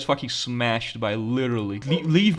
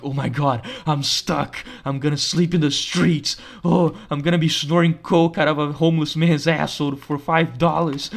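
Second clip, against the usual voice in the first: panicked inner voice; 3 semitones higher, 4 dB louder than usual. Retold or imitated.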